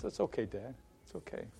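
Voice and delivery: very quiet, forgiving reply; soft acceptance. Retold or imitated. Son accepting dad's apology, very soft. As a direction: let it go in a small, hushed voice.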